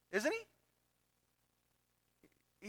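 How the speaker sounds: high, soft questioning "Isn't he?"; rhetorical prod. Acted. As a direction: coax the congregation to answer.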